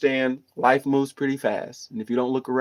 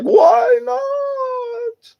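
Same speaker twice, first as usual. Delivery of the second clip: much higher mock voice of his old mentor; mentor impression; 4 dB louder than usual. Imitating his mentor Doc asking why he won't cry, much higher voice. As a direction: squeak it out like his late teacher. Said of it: "That's how he would talk".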